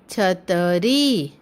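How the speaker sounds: छतरी slowly, syllable by syllable, ending on a long री; drawn-out teaching demo of छतरी. Shown as a demonstration. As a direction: stretch the final ee of छतरी. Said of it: "शब्द का उच्चारण थोड़ा लंबा होता है".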